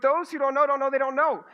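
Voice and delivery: tongue twister again, pushed harder; mock tongue twister. Shown as a demonstration. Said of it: "I'll just say this ten times fast".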